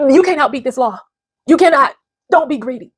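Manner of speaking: ordinary talk, nothing marked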